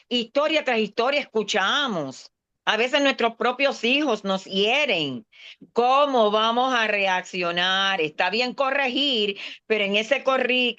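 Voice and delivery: usual speaking voice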